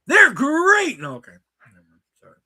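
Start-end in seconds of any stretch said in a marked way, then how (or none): 0.1-1.0 put-on gruff old man shout. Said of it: "old man grabbed me by the collar".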